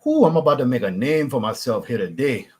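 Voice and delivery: bayou Cajun with a big opening "Ooh"; flatter Cajun accent. Shown as a demonstration. Demonstrating the authentic bayou side of it, flat Cajun accent. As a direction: deliver it in a flat South Louisiana Cajun accent. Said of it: "It's a little flatter".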